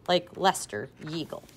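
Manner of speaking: usual speaking voice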